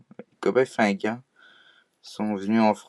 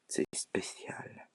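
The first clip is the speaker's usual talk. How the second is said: whisper; 14 dB quieter, much slower than usual. Real, not acted. Intimate aside to viewers.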